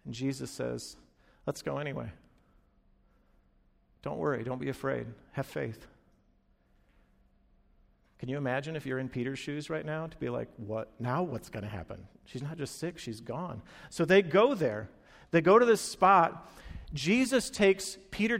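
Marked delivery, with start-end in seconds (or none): 1.5-5.9 soft, low and calm comfort; hushed, tender steadiness, with pauses; gentle reassurance. Acted. Jesus reassures grieving father. 10.6-13.6 low, hushed bewilderment; quiet, dumbfounded aside; stunned disbelief. Acted. Peter's stunned inner reaction.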